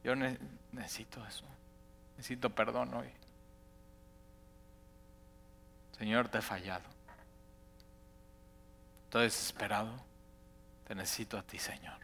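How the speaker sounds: soft, hushed plea that fades to a whisper; a repentant sinner praying softly to God; tearful prayer. Pretend. Worshipper confessing to the Lord, hushed and pleading. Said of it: "O puedes estar llorando y diciendo".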